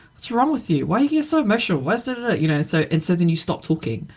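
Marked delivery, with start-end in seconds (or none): 0.1-2.4 mock exasperated husband, higher pitched; imitating annoyed husband. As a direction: throw it back at her like a dismissive husband, pitched up. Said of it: "he would be like".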